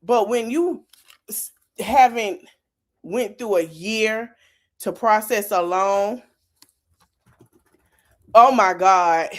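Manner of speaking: ordinary talk, nothing marked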